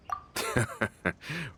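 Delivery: soft, breathy caught-out chuckle; sheepish laugh. Real, not acted. The companion caught hinting about joining, sheepish laugh. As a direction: laugh when your plan is seen through. Caught being too obvious.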